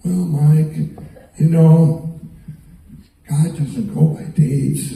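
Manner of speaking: slowed-down, lightly imitated Ben. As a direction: drag it out like Ben, in no hurry. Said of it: "Ben talks slow".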